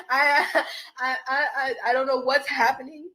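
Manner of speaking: ordinary talk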